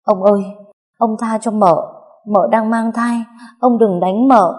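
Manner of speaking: high, loud, desperate plea; Hồng, tearful begging. A narrator's reading. Maid begging the master to spare her pregnant mistress, tearful pleading. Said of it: "vừa khóc vừa van xin".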